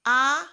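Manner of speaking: high, loud French "A". Shown as a demonstration. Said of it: "We sound it as".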